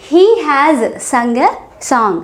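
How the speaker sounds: each word slowly and separately for learners; deliberate teacher pacing; slow model sentence. Shown as a demonstration. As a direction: spell it out slowly for the class. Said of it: "teacher models the sentence".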